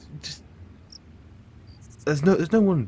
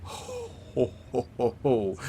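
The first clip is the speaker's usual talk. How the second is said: scoffing ho-ho-ho; 2 semitones higher, slower than usual. Acted. Villain scoffing at the war room's price, theatrical chortle.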